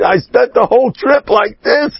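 high, laughing through the words; squashed friend laughing high as he recalls the trip; laughing retelling. Retold or imitated. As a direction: tell it while rolling with laughter. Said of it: "And he's rolling".

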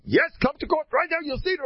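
high, loud cocky insistence; brazen demand. Acted. Sodom man dragging Eliezer to court, high loud cocky.

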